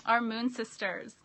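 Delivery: ordinary delivery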